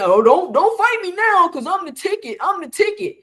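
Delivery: high, mocking quote of a boastful fighter; sarcastic high mimic of the fighter; mocking imitation. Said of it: "Why are you saying".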